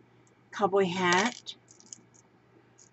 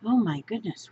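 ordinary talk, nothing marked

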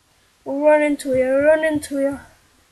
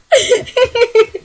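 laugh; 7 semitones higher, 6 dB louder than usual. Real, not acted. Nervous laughing after a joke.

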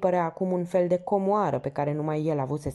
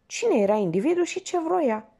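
a burst of raised, sharper pitch; outburst; 11 semitones higher, 3 dB louder than usual. Acted. Young man bursting out at the porter, a mild outburst. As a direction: demand it suddenly, higher up. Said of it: "izbucni el".

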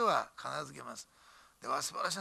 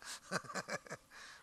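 soft breathy laugh; 2 semitones higher, 9 dB quieter than usual. Real, not acted. Laughs at pastor joke.

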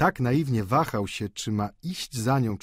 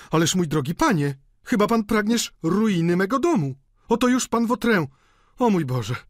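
high-pitched, agitated protest; landlady's agitated outcry; exclaiming; 10 semitones higher, 4 dB louder than usual. A narrator's reading. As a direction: wail it in alarmed protest. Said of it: "zawołała, przerywając sobie".

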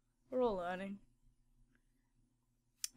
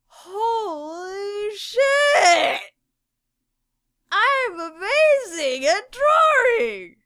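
higher, much louder, slow triumphant yell; overjoyed shout; 8 semitones higher, 10 dB louder, much slower than usual. Pretend. Artist thrilled by his three-triangle hair, slow triumphant yell. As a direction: bellow it like you just made a masterpiece.

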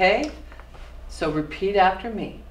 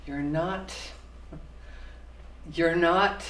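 usual speaking voice

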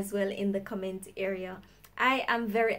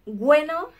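Bueno said louder with a rise, brushing them off; dismissive bueno; 4 semitones higher, 8 dB louder than usual. Retold or imitated. To someone annoying you, a loud dismissive Bueno. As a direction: shoo them with a sharp Bueno. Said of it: "Just say".

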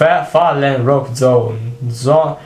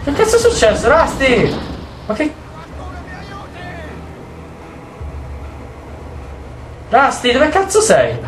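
alarmed shouting; 11 semitones higher than usual. Real, not acted. Player panics finding wounded Rusty.

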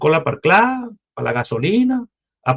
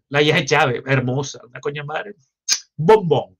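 ordinary talk

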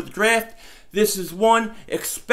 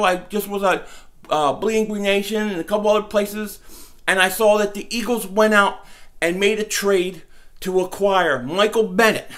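ordinary talk, nothing marked

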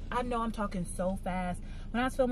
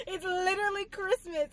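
"It's literally Christmas" squealed much higher, half crying with joy; excited squeal; 11 semitones higher than usual. Real, not acted. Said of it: "I'm so excited".

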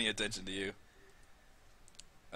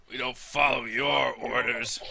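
a slow, lightly voiced gruff guard; 5 dB louder, slower than usual. Guard leader refusing the party's orders, slow and lightly gruff. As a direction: refuse the intruder slowly, a light growl. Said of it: "He says".

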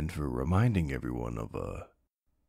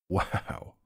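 intimate whisper; 3 semitones higher than usual. Acted. Flustered by flirting.

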